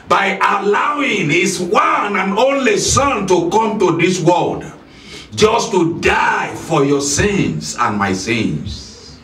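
normal talking voice

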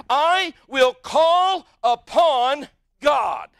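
slow, drawn-out shout calling on God; shouted prayer. Acted. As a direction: cry it out slowly, full voice. Preacher shouts David's cry.

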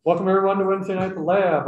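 loud, bright host welcome to the crowd; loud announcer-style welcome. Acted. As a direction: open the show like a host.

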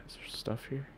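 sighed, rising whisper; whispering while hiding. Real, not acted. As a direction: whisper so she can't hear.

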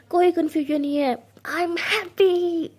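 loud, drawn-out happy cry; joyful outburst. Acted. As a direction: shout the joy out slowly. Joyful declaration while twirling.

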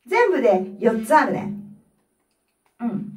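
usual speaking voice

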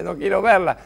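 high, loud frightened cry; Spanish: grito asustado de madre; mother's panicked cry. Retold or imitated. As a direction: cry out in panic at the child climbing. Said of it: "decía".

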